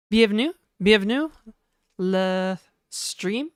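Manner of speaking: hammed-up French accent welcoming to the stream; mock French accent. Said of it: "my terrible French accent".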